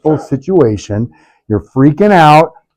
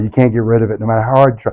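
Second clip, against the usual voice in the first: normal talking voice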